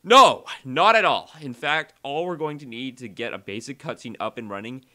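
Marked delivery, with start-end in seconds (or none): 0.0-1.3 emphatic no. Real, not acted. Reassuring after mock worry.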